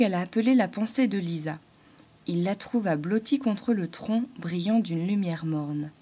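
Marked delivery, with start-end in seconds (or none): none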